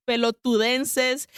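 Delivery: ordinary delivery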